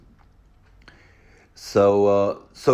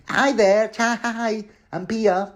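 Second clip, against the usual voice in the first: flirty Pia; 13 semitones higher, slower than usual.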